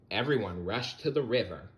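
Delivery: ordinary delivery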